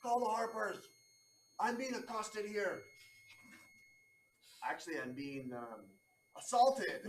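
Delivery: loud, high, frantic calls for help; panicked shouting diplomat. Acted. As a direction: yell for the guards like an outraged official. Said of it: "He's like".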